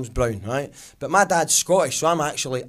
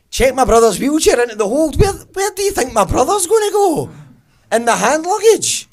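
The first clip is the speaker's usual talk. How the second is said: loud, high-pitched, outraged disbelief; shrieking indignant questions; incredulous outburst; 10 semitones higher, 8 dB louder than usual. Acted. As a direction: shout it back as if it's absurd. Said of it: "I'd have a really incredulous response".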